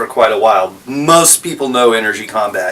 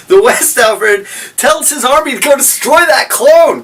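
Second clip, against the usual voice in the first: much higher, playful redo of the line; squeaky mock retake; 11 semitones higher, 3 dB louder than usual. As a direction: replay it in a silly high voice.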